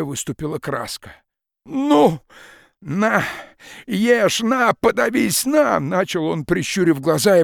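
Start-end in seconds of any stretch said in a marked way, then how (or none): 1.7-5.8 high, loud choking rage; furious outburst. Acted. Peasant hurling words at the forester, furious shout. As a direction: fling each word at him like a blow.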